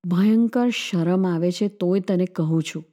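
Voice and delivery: ordinary delivery, nothing marked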